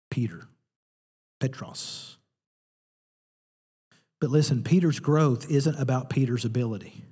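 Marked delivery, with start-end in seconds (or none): 1.4-2.2 Petros, hushed and breathy; Greek name. Shown as a demonstration. Preacher hushing the Greek word Petros for rock. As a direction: whisper Petros carefully. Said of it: "Greek word is rock".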